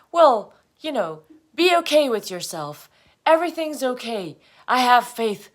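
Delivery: high, loud affirmations; insistent self-reassurance. Acted. Talking herself out of failure, loud high affirmations. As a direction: insist on it as a forced pep talk.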